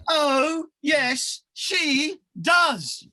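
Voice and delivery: loud pantomime retort; panto shout. Acted. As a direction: bellow it back at the crowd.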